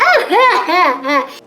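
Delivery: high, exaggerated fake sobs; imitated hysterical sobbing; mock crying. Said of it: "she's crying in there".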